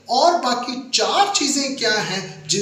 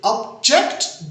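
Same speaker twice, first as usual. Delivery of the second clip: slow object with a high, stressed "JECT"; second-syllable stress; 5 semitones higher, slower than usual. Shown as a demonstration. Teacher explaining the verb to learners, object stressed on JECT. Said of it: "जेक्ट पे जोर डालने से".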